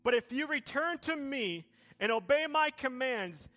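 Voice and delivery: loud, high, building sermon delivery; full-force preaching. Acted. Pastor preaching to the church, loud and rising. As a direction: preach it out over the music to the congregation.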